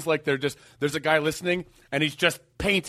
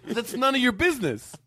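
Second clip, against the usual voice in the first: mock protest; 7 semitones higher than usual. Litigant protesting to TV judge.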